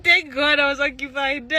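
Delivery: high, laughing while quoting; laugh-crying quote; quote through laughter. Retold or imitated. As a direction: read it out while cracking up. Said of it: "She said".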